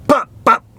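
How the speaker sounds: high, loud mouth-made gunshot pops; mock gunshots. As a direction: fire off two cartoon shots with the mouth. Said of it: "And then he goes".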